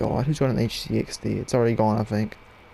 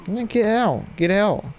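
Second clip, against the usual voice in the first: higher, scornful get out; 7 semitones higher than usual. Real, not acted. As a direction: shoo the intruder away. Shooing an enemy from his spot.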